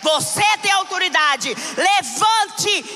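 loud, high, shouted sermon; fiery preaching. Acted. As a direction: preach it out at full force. Preaching at full force.

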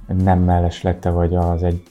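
ordinary delivery

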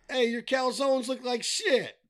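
high, loud Chicago Italian voice; Italian accent. Retold or imitated. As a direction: ham up a Chicago Italian guy. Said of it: "in that accent by the way he wrote it".

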